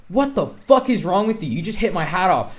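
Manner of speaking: mock yell of outrage at them; retold angry scream. Narrator yelling at the gang after his hat falls, mock angry yell. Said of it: "screaming at them".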